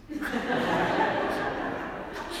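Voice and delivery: quick airy laugh; real laugh. Real, not acted. Priest amused at offering TLC, airy chuckle. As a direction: chuckle under the breath.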